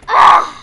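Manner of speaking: short, loud burst of frustration; frustrated yell. Real, not acted. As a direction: yell out at a lost round. Dies in the game.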